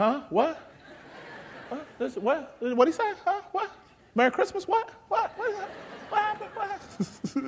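puzzled murmurs of baffled churchgoers; confused congregation. Retold or imitated. Pastor mimicking his congregation, startled and confused. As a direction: act out heads popping up in confusion.

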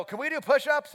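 put-on high, loud childlike pleading; eager kids. First graders asking coach for push-ups, high and eager. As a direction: imitate excited little kids begging.

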